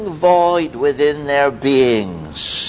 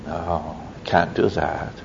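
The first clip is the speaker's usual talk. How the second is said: much softer, low, gentle no; soft refusal; 8 semitones lower, 8 dB quieter than usual. Acted. Preacher rejecting the disciples' dismissal, soft and gentle. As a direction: quietly turn the idea down.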